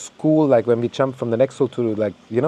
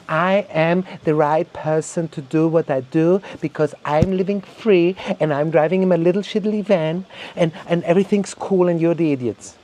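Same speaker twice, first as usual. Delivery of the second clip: mock smug declaration, slightly raised, drawn out at first; lightly acted holier-than-thou boast; self-righteous mockery; 4 semitones higher than usual. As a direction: parody someone proudly preaching their own virtue. Mocking self-righteous van dwellers.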